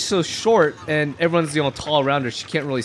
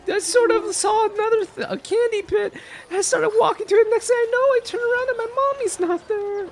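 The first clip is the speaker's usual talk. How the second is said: put-on very high child voice, plaintive; squeaky, whimpering five-year-old; tearful little girl. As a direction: tell it like a weepy lost child.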